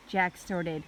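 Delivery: ordinary delivery, nothing marked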